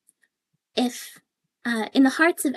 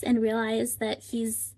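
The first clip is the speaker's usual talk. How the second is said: ordinary talk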